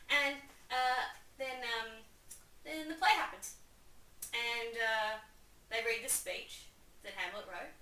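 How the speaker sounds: usual speaking voice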